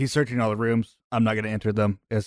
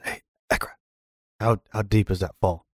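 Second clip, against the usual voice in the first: hushed question across the room; whispering; 2 semitones lower than usual. Acted. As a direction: keep it to a whisper.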